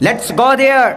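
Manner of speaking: slow, proud English line, "berth" drawn out; proud English accent. Retold or imitated. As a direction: show him the maulana can speak English. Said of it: "مولانا انگریزی نہیں بولتے".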